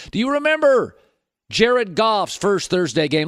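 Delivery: ordinary talk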